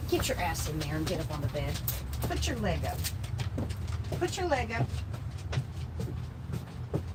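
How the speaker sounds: soft, high coaxing murmurs to a dog. Real, not acted. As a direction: gently urge a dog to climb up. Coaxing dog onto bed.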